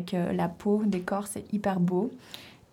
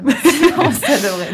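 laughing, much higher and louder; 6 semitones higher, 11 dB louder than usual. Real, not acted. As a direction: laugh through the end. Joke about family conflicts.

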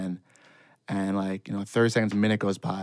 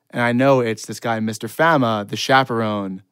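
usual speaking voice

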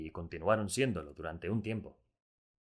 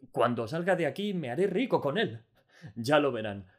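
much higher and louder with delight; overjoyed; 7 semitones higher, 7 dB louder than usual. A narrator's reading. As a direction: brag happily about getting rich. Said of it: "rebosante de alegría".

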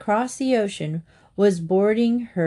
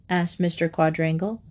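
ordinary delivery

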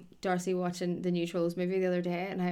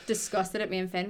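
ordinary talk, nothing marked

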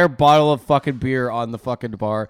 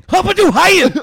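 menacing speech-maker's shout, high and very loud; Hitler; 12 dB louder than usual. Acted. Host imitating menacing wartime speeches, hammed-up ranting shout. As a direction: bellow mock German like a ranting dictator. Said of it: "Hitler impression".

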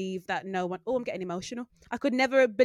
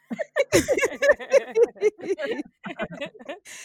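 shrieking burst of laughter; cracking up after a cheeky joke; loud high laugh; 12 semitones higher, 7 dB louder than usual. Real, not acted. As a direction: cackle at your own joke.

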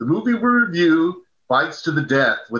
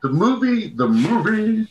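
high strained hoarse voice; struggling hoarse voice; voice cracking; 8 semitones higher than usual. Real, not acted. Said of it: "I'm not talking well".

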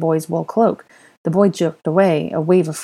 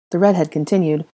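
ordinary delivery

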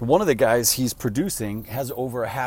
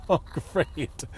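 higher, dry ironic oh great; sarcastic oh great; 5 semitones higher than usual. Retold or imitated. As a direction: pretend delight, really dreading it. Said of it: "And I'm like".

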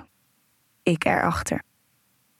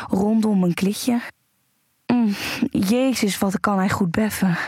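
aroused narration. Acted. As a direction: let the pleasure build as you tell it. Aroused first-person erotic narration.

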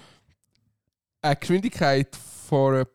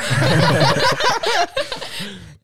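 loud, high burst of laughter; 5 dB louder than usual. Real, not acted. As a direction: crack up loudly.

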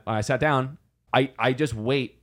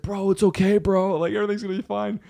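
high-pitched, fast, reassuring bro voice; calm him down like a high partygoer; lightly hammed-up comforting bro; soothing stoner bro; 8 semitones higher than usual. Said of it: "It's like".

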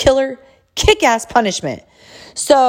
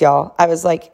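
ordinary delivery

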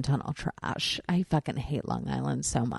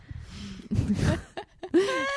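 higher-pitched burst of laughing; laughter; 11 semitones higher, 3 dB quieter than usual. Real, not acted. Host laughing after the microphone hit their teeth, rising laughter.

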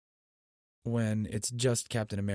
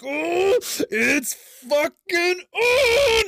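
loud, high, stretched-out shout; hype shout; 10 dB louder, much slower than usual. Retold or imitated. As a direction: roar it like the battle just started. Battle heroes return, hype.

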